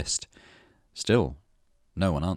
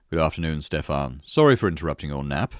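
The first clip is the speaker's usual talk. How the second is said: lower-voiced, insincere courtesy; unapologetic apology; 4 semitones lower than usual. A narrator's reading. Cyril greets the man he woke, not sounding sorry. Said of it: "not sounding sorry at all".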